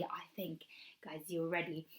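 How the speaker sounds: soft whisper. Real, not acted. Teacher whispering to children before the story. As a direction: hush them before the story starts.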